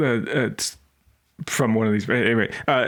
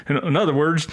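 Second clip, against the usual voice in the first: ordinary delivery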